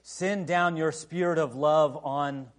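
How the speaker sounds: slow reverent prayer voice; hushed pastoral prayer. Acted. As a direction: pray over the congregation slowly. Leads closing prayer.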